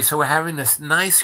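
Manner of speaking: plain reading voice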